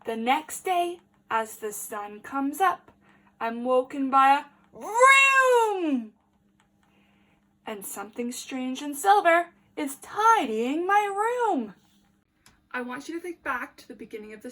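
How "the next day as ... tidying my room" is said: put-on lively read-aloud for small children; story reading. A narrator's reading. Teacher reading a picture book to the class, lively.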